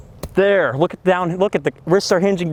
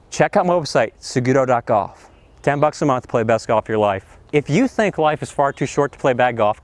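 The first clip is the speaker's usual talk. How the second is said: ordinary delivery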